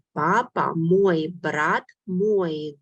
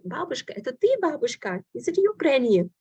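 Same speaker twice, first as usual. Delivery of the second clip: higher, lightly voiced young girl in disbelief; a teacher playing a little girl, a touch higher; girl amazed at grandma; 7 semitones higher, faster than usual. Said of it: "So she says".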